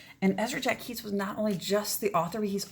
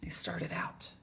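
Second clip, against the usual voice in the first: whisper; 4 semitones lower, 6 dB quieter, slower than usual. A narrator's reading. Narration whispered for suspense.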